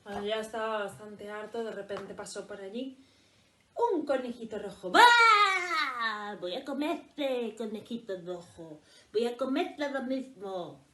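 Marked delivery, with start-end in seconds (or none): 4.9-10.8 louder, slow hungry growl; wolf's new threat. Acted. Wolf threatens the red rabbit, loud and slow. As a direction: lunge with the threat.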